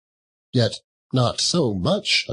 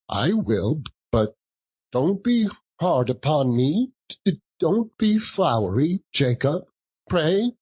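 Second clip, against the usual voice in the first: put-on higher, stammering old miser begging for mercy; pleading Scrooge; 7 semitones higher than usual. A narrator's reading. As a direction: plead nervously, stumbling on don't.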